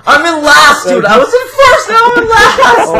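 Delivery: loud, very high wail of frustration; anguished complaint. Real, not acted. Player dropped from first to last, anguished wailing.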